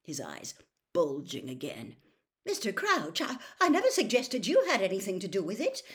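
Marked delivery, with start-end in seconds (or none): 2.4-5.8 high, hurried, stammering protest; flustered protest. A narrator's reading. Amos Diggory denying he suggested anything, flustered. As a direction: rush to deny it, embarrassed. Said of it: "muttered Amos Diggory".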